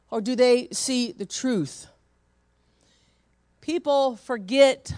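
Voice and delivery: loud, high preaching build; preaching at full force. Acted. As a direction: lift the room, preacher's full voice.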